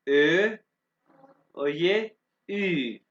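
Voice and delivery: ordinary delivery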